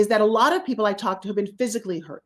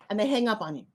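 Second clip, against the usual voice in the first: normal talking voice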